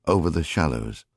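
ordinary delivery, nothing marked

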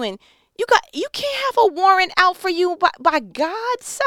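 ordinary talk, nothing marked

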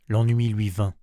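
usual speaking voice

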